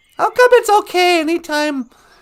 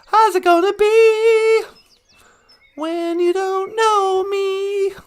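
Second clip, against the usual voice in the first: mock dramatic; 5 semitones higher, 4 dB louder, slower than usual. Dramatic playful line.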